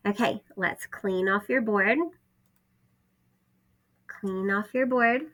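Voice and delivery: ordinary talk, nothing marked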